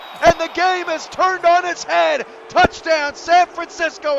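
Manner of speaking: loud, high touchdown call; excited call. Real, not acted. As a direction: belt out the touchdown.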